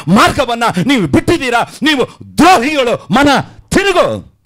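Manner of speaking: building, rising preacher's rebuke; fiery preaching. Acted. Pastor rebuking his flock, building to a cry. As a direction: hammer each charge home, climbing in pitch.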